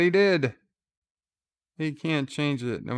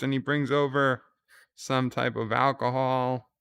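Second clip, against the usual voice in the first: ordinary delivery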